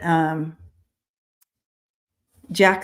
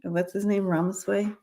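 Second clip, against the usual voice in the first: usual speaking voice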